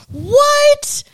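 high squeaky burst of astonishment; mock surprise. Host suddenly gasping over SpaceX, mock surprise. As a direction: pretend to be floored, way up high.

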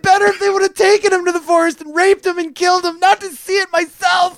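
very high, melodramatic mother's wail; hysterical mother. Acted. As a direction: shriek and sob in hammed-up grief. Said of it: "And his mother's like".

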